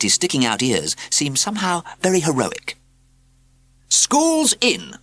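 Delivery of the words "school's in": high, loud shout; shouting teacher. A narrator's reading. Wizard teacher bursts in shouting at pupils. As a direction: call the class to order. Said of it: "he shouted".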